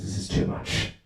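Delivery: hushed, voiceless exasperation; whispered inner thought. Acted. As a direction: whisper it to yourself at night. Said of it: "the wife, she thinks".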